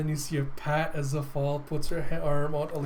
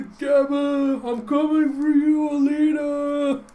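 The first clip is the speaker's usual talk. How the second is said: high, loud charging battle cry; Teagle; 11 semitones higher, 7 dB louder, slower than usual. Acted. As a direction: holler it while rocketing to the rescue. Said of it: "Teagle just takes off".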